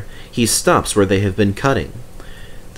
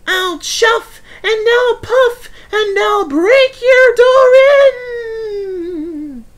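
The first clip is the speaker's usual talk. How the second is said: very high, loud, slowing ghostly howl; Percy the ghost howling his threat at the shed door; mock wolf threat; 6 dB louder, much slower than usual. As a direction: draw out the threat like a big bad wolf.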